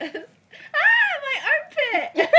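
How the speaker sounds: squealing as the snake tickles; being tickled. Real, not acted. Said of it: "tickling my".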